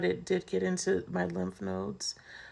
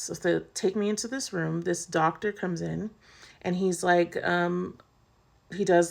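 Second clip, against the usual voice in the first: normal talking voice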